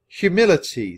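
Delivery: high, drawn-out echo of a new word; slow, pondering. A narrator's reading. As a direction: sound it out slowly, high and loud. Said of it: "the prince said slowly".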